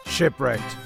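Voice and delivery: usual speaking voice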